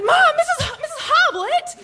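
high, shrieked plea to mom; panicked high-pitched outcry, re-enacted; flustered protest. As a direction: squeal in protest, stumbling on the name.

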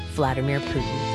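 usual speaking voice

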